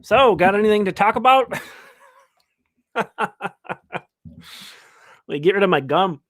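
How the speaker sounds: talking while chewing gum; words around a wad of gum. Real, not acted. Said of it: "stress chewing a piece of gum".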